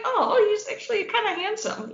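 high-pitched, cooing surprise; retold smitten reaction. Woman retells first seeing her date on camera, smitten. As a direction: coo it high, as if pleasantly surprised.

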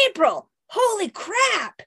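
high, slow shocked gasp of a line; stunned at her injuries. Acted. Raph shocked at April.

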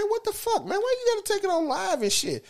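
high, disbelieving squeak at the screen; mock outraged high voice; incredulous high squeal. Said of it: "I'm like".

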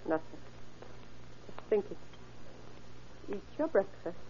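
low, hushed and drained, almost to herself; ominous quiet. Acted. Mother quietly saying goodbye to her son at breakfast, hushed and ominous. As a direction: murmur a final goodbye he must not catch. Mother's eerie farewell before shooting.

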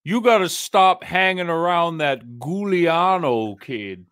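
imitated coach, words stretched out; old crew coach. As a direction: scold slowly like an old coach. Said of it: "He goes".

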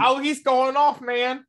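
high, loud mock commentator hype; Boyer. Co-host joins in mimicking Boyer, high and loud. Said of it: "And Boyer's gonna be like".

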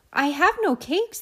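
high, small rabbit voice; timid rabbit. Acted. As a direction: squeak it out like a nervous little rabbit.

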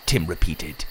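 usual speaking voice

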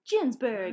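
"Ginsberg" said softly with an opening J sound. Shown as a demonstration. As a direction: drop the volume and say "Ginsberg" as "jins-berg". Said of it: "I'm always inclined to say Ginsberg".

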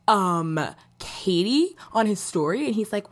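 usual speaking voice

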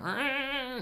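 high yowl like a stray cat in heat; imitated cat yowl. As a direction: mimic the stray cat noise. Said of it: "they make that ... noise".

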